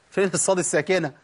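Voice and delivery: ordinary talk, nothing marked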